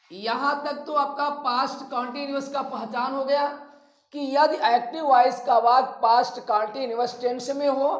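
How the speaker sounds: ordinary talk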